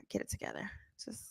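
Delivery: barely audible whisper; whispered aside. Real, not acted. Telling self to pull it together, whispered. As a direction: whisper it to yourself.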